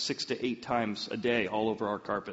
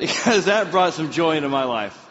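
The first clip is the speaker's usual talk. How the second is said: loud, laughing through the words; 5 semitones higher, 10 dB louder than usual. Real, not acted. Telling the congregation about the joy, laughing loudly. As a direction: let the laugh carry the line.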